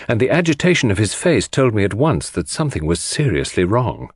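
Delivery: plain reading voice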